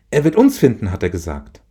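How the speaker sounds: put-on a child repeating grandpa's promise; confident little girl. Child Franzi voiced in story.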